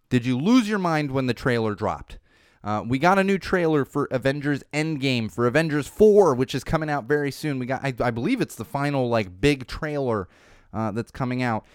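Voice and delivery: ordinary talk, nothing marked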